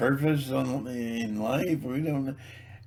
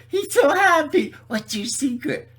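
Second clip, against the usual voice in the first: high, bright mock voice of a happy convert; sing-song mock admirer; mocking cheery believer; 13 semitones higher, 6 dB louder than usual. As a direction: ham up a gushing churchgoer. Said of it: "'cause people go".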